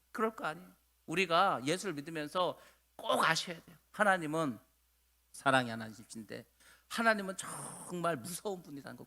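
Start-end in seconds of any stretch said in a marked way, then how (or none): none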